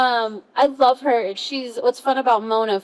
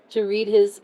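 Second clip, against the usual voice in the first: ordinary talk, nothing marked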